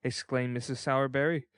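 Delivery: ordinary delivery, nothing marked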